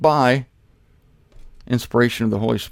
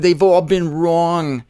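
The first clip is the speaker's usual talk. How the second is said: ordinary talk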